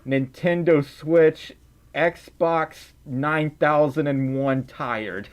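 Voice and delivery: Nintendo Switch and Xbox nine thousand and one tired said slowly and stretched; drawn-out exaggeration. Streamer hamming up how tired she is, slow and drawn out. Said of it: "super ultra mega deluxe".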